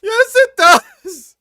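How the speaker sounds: high, loud, drawn-out yell; outraged yell. Real, not acted. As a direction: shout back in disbelief. Yelling back at the video.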